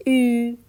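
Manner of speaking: "او" held long as German ü; drawn-out u-umlaut. Shown as a demonstration. Teacher shows learners the stretched sound, "او" as long ü. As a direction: stretch "او" into a steady ü. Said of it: "کشیده".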